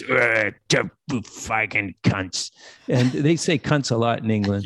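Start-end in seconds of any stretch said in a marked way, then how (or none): 0.0-2.5 put-on drunk grumble. Drunk Reed muttering insult.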